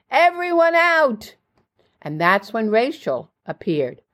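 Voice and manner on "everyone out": high, drawn-out yell; acted scream, pitched way up and stretched; screamed order. A narrator's reading. Hostess clears the party after unplugging the stereo, screamed. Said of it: "and screamed".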